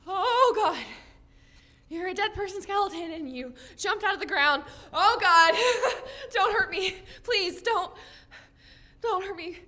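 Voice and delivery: high, fearful rush with gasping breaths; panicked pleading. Acted. Girl begs the skeleton not to harm her, panicked. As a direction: plead for your life, close to tears.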